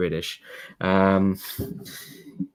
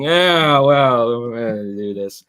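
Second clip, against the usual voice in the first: abashed dudes; 5 dB louder, slower than usual. Acted. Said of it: "abashedly rubbing the back of their head, going".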